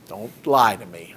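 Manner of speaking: ordinary talk, nothing marked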